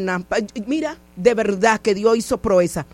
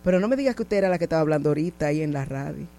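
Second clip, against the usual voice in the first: low, quick, softer driver's voice; retold taxi driver; 9 semitones lower, 6 dB quieter, faster than usual. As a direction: lightly voice the driver, pitched well down. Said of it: "Y me dice".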